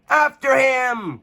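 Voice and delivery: high, loud, slow chase cry; cried. A narrator's reading. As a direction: send the knights after the goblin. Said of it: "cried Sir Tyrone".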